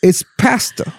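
high preacher insistence; lightly voiced Southern preacher. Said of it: "my Southern preacher voice".

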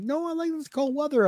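high, soft mock wife voice; imitating wife. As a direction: pitch it up to play the wife, lightly. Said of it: "She's like".

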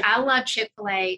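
ordinary talk, nothing marked

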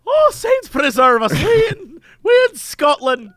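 mock brogue, loud and slow; hammed-up Irish accent. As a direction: cry it out like a stage Irishman. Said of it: "It started out Irish and switched over".

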